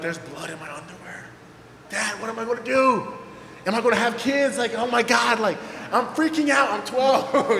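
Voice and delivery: put-on high, loud, frantic retelling of a scared twelve-year-old; panicking boy, half laughing; panicked kid. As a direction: freak out like a kid who thinks he's ruined. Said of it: "I am losing my mind".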